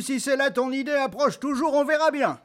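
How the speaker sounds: high daring goat voice; big goat defiant. Acted. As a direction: dare him to come. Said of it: "dit Barbu".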